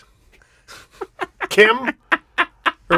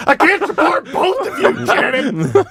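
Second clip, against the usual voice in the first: mock shout; 6 semitones higher than usual. Mimicking ad character.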